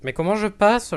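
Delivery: frustrated loud question. Real, not acted. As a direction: complain loudly about being stuck. Stuck in game, frustrated.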